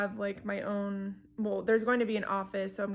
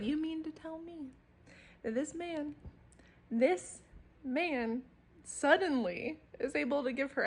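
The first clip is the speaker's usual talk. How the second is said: higher-pitched disbelief, broken by pauses for effect; incredulous; 5 semitones higher than usual. Real, not acted. Vlogger questions the book hero's sudden success, incredulous. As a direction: spell out the absurdity piece by piece. Repeats disbelief at book's plot.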